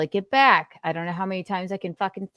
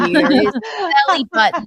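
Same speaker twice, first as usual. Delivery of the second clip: laughing singsong; 8 semitones higher, 8 dB louder than usual. Pretend. Teapot parody, laughing.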